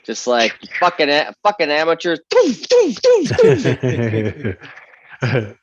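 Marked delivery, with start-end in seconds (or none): 0.8-2.1 scornful shout at a rookie; mocking enemy shout. Player voices enemy mocking him. 2.3-5.6 gunshot noises. Retold or imitated. Imitating gunfire.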